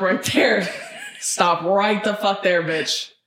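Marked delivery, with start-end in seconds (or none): none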